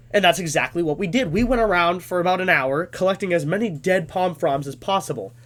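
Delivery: ordinary delivery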